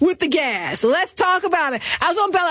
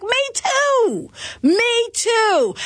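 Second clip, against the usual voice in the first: higher, emphatic me too; eager agreement; 7 semitones higher, much slower than usual. Retold or imitated. Agreeing with the brother, higher and eager. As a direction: jump in to agree. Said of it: "I'm like".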